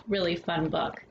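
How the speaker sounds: ordinary delivery, nothing marked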